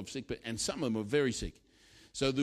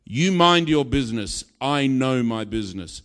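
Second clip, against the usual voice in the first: loud, forceful put-down; stern retort; 8 dB louder than usual. Acted. Preacher answering the heckler eye to eye, stern loud retort. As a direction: fire it back firmly, brooking no argument. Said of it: "looked him in the eye".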